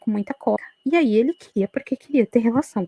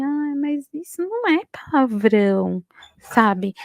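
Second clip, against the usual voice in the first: slow, higher, mock-innocent shrug; playing innocent; 4 semitones higher, slower than usual. Mother-in-law brushing off the insults, slow mock-innocent. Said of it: "Toda sonsa".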